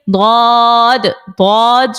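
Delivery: ضاد as a heavy, long "dawd"; Arabic dad. Shown as a demonstration. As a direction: draw out ضاد with a thick Arabic d. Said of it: "ہم اس کو ضاد پڑھتے ہیں".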